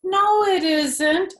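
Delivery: high, slow, drawn-out whine; whining. A narrator's reading. Junie B whines back at her parents, dragging the words out. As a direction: whine it like a sulky kid. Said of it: "I whined at those two".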